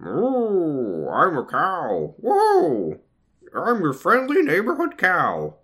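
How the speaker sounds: high, slow goofy cow. Acted. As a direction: play a friendly cartoon cow. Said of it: "invent a fun talking cow character".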